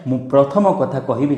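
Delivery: usual speaking voice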